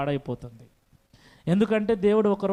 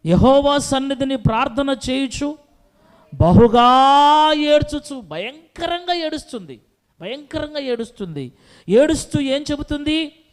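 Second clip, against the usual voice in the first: impassioned preaching; 7 semitones higher, 7 dB louder than usual. Acted. Preacher tells of Hannah weeping, high and loud. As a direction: cry the verse out to the church.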